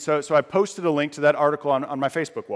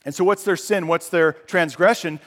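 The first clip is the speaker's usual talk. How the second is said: ordinary delivery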